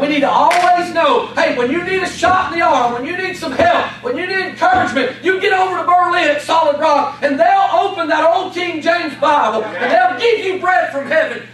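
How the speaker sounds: fired-up preacher calling out to the church; preaching at full force. Acted. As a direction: build to a fervent call, shouting.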